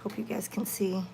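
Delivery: soft unvoiced whisper; hushed aside. Real, not acted. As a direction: whisper so as not to disturb. Whispering on a quiet stream.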